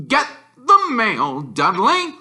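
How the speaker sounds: high, loud, slow caricature of an uncle; Uncle Vernon ordering. Acted. Uncle ordering Dudley from behind his paper, high slow command. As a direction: bark it slowly as an order. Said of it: "said Uncle Vernon".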